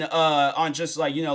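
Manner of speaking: ordinary talk, nothing marked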